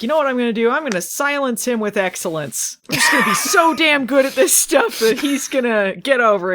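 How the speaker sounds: playful fired-up pronouncement; mock determination. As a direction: declare it with hammed-up resolve. Voices Maggie's determined vow.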